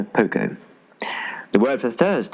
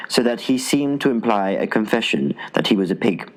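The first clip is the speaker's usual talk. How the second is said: ordinary delivery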